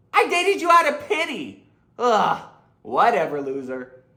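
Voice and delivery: mock snooty brush-off; scornful comeback. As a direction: toss it off with contempt. Dumped girlfriend snaps back in skit.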